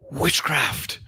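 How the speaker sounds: high startled shout; frightened cry. Acted. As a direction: cry out in alarm.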